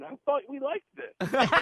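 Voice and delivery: high, slow teasing voice of the water; mocking waterfall voice. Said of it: "splashing on my back going".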